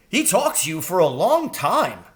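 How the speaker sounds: high, quick crow voice; eager young crow. A narrator's reading. Jeremy the crow urging Mrs Frisby home, high and anxious.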